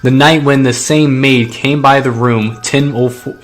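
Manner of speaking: loud, dramatic true-crime read over music; booming documentary narrator; story narration. Acted. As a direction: narrate the mystery with big projected weight.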